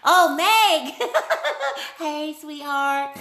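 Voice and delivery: loud high delighted hello with laughter; delighted greeting. Real, not acted. Vlogger spotting a friend in chat, delighted laughing hello. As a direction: greet a friend you are thrilled to see.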